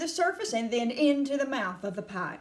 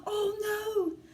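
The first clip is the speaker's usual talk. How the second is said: high-pitched, imploring Mr Wonka; high anguished plea; distressed pleading cry; 11 semitones higher than usual. A narrator's reading. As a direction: cry out in dismay, begging him to stop. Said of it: "he cried out".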